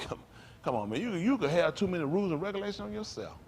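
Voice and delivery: ordinary talk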